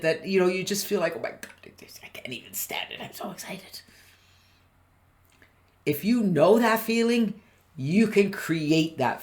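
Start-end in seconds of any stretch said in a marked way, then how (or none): 1.2-3.8 whispered giddy excitement; breathless excited whisper. Acted. Coach whispering the giddy excitement she wants callers to feel. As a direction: hush it like a kid on Christmas morning.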